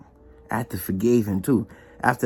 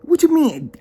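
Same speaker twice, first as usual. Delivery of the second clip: higher and louder, outraged; retold angry disbelief; indignant; 9 semitones higher, 5 dB louder than usual. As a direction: demand an explanation. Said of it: "I said".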